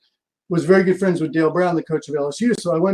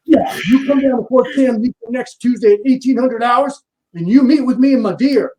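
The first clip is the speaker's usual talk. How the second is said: higher and louder mock sergeant giving orders; army stepdad setting a meeting, commanding; booming drill sergeant; 6 semitones higher, 5 dB louder than usual. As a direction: bark out the time like a drill sergeant. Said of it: "I hear this booming voice".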